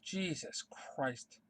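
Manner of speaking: very soft, slow and low; stunned disbelief. Real, not acted. Fan spent after ranting about horrible comics, hushed disbelief. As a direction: let it out slowly, hushed and spent.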